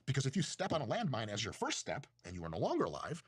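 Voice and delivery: normal talking voice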